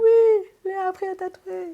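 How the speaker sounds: very high, loud mock squeal; imitating excited supporters. Listener mimics proud Peruvian mums cheering Léa on, high mock squeal. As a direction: gush it in a put-on high voice. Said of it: "elles sont vraiment là en mode".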